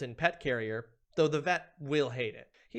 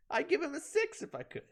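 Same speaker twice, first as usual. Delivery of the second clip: lingering swoon; 12 semitones higher than usual. Acted. Still swooning over Hive Knight.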